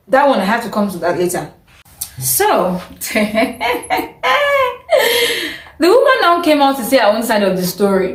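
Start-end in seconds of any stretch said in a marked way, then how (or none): 3.0-5.6 long high giggle; amused laugh. Real, not acted. About to tell the woman's side, high laugh. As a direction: break into high, rippling laughter.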